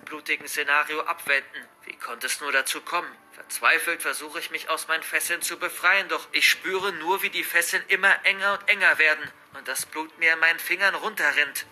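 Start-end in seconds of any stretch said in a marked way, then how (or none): none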